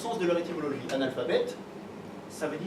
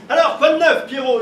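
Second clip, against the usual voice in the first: calling out; 6 semitones higher, 10 dB louder than usual. Retold or imitated. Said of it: "tu lui demandes".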